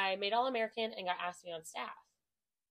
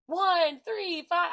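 loud high stunt count; 9 semitones higher, 9 dB louder, much slower than usual. Shown as a demonstration. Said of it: "I might count".

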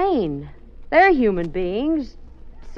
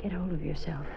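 urgent whisper; 4 semitones lower, 11 dB quieter, faster than usual. Acted. Urgent hushed plea.

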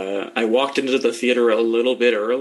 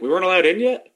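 mock innocent confusion; 7 semitones higher, 5 dB louder, faster than usual. Acted innocent quote.

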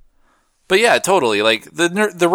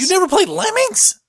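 high-pitched disbelieving question; incredulous. Real, not acted. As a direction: ask it in squeaky disbelief. Disbelief at friend not knowing game.

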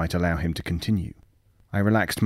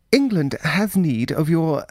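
nervous official; 8 semitones higher, 3 dB louder than usual. Acted. Chambers voiced, nervous official.

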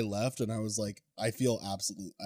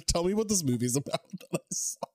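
laughing mock question; 7 semitones higher, faster than usual. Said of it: "I'm like".

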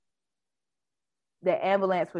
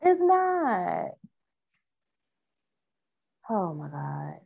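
higher, exasperated complaining; 5 semitones higher than usual. Real, not acted. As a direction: vent at the glitchy screen. Complaining about the touchscreen.

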